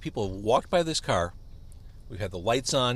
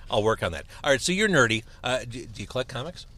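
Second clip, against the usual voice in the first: ordinary talk, nothing marked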